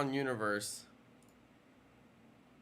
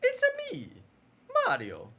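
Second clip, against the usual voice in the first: Mario; 4 dB louder than usual. Pretend. Streamer auditioning for the Mario part, high cartoon impression. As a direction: squeak the greeting high, then swoop down on the name. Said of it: "auditioning for Mario".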